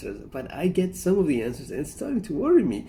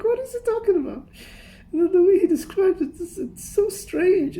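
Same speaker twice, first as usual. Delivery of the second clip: laughing disbelief; 13 semitones higher, 3 dB louder than usual. Real, not acted. Laughing at friend's odd story.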